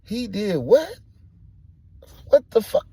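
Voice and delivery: loud, very high-pitched disbelief; incredulous yell; shocked outburst. Retold or imitated. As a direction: explode in shock at the news. Acting out shock at news.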